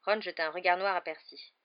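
ordinary delivery

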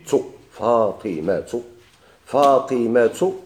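فاطمة stretched very slowly with a clear final tu, فاطمةُ; drawn-out demo ending. Shown as a demonstration. Teacher drawing out فاطمة so students hear its ending. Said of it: "شوف فاطمة".

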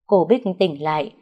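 ordinary delivery

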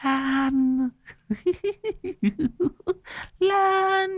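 high, soft eerie cackling voice; maniacal cackle. A narrator's reading. Possessed girl points at her friend, cackling eerily. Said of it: "rồi cười sằng sặc".

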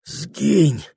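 high, loud burst; sharp banishing cry. A narrator's reading. Прохор ordering the witch gone, banishing cry. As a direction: drive the apparition away.

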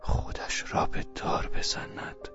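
slow, hushed echo; incredulous brooding. Acted. Velchaninov doubting it, slow hushed echo. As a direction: mull it over in disbelief.

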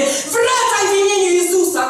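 high, loud commanding cry; shouting command. Retold or imitated. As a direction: order her spirit back. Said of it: "krzyczałam do jej ducha". (krzyk w imieniu Jezusa)

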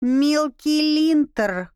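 "Мелкий линтер" said slowly as a slip for Милки Линтер; mangled name. A narrator's reading. Girl mangling the bat's name, slowly.

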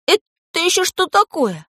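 high, slow astonished question; stunned boyish disbelief; amazed. A narrator's reading. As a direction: gape at it, voice shooting up. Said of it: "Пашка изумлённо спросил".

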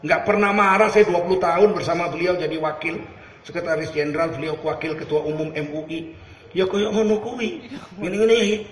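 normal talking voice

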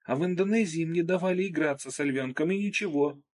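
put-on high, whiny girl's voice; young girl voice. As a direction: squeal it like a girl in pain. Narrator voices the girl arguing.

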